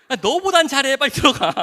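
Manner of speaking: loud, high, playful telling-off; doctor yelling at the boy to get back to bed; shouted scolding. Retold or imitated. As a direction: shoo the kid back to bed with a yell. Doctor shooing patient away.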